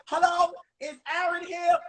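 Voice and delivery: put-on high-pitched old lady calling attendance; hard-of-hearing old teacher. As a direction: call the roll as a half-deaf old teacher. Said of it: "And she couldn't hear".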